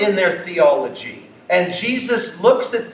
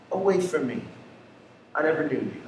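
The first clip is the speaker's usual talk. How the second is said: soft, low, final words of judgment; a hushed, solemn rejection; hushed verdict; 5 semitones lower, 9 dB quieter, much faster than usual. Retold or imitated. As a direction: let the sentence fall quiet and heavy.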